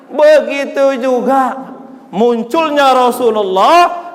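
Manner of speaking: high, loud impassioned sermon; fiery preaching. Acted. Preacher warning the congregation, loud and impassioned. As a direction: drive the point home hard.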